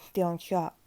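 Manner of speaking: plain reading voice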